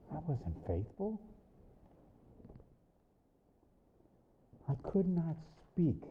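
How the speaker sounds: sudden drop to a soft voice; hushed confession. Real, not acted. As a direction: confess it softly, with long pauses. Confession right after the outburst.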